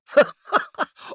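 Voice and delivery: amused belly laugh; hearty laugh. Acted. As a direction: burst of high hearty laughter. Character amused watching a guy.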